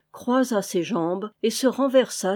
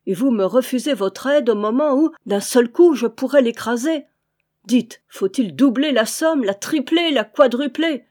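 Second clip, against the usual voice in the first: louder, higher, urgent bargaining; heated pleading; 4 semitones higher, 6 dB louder than usual. A narrator's reading. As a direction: press desperately, climbing with each offer. Desperate master pleads, raising offer.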